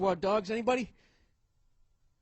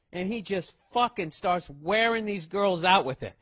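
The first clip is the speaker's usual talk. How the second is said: usual speaking voice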